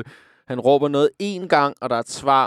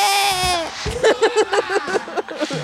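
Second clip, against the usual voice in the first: laughing. Real, not acted. Host laughing at the recording.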